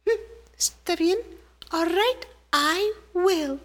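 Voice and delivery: high tiny ant voice agreeing; little ant. Acted. Little ant agreeing to go demand justice, high voice. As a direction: squeak a determined yes.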